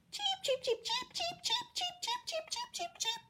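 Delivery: very high, soft frantic cheeping; mother robin calling in alarm; bird cheeps. Acted. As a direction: tweet like a panicked robin. Narrator imitates alarmed mother robin.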